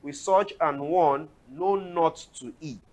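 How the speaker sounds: loud, declamatory Bible reading; scripture reading. Acted. Host reads Corinthians aloud for the pastor, loud and declamatory. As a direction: read the verse out like scripture in church.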